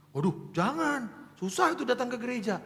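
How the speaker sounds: a son's anxious no; worried refusal. Retold or imitated. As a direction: protest that Papa will never come. Child refuses inviting father.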